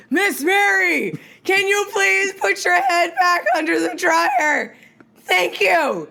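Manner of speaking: high, slow, drawn-out call. Retold or imitated. Stylist asks deaf client back under dryer, calling out slowly. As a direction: spell it out for someone hard of hearing.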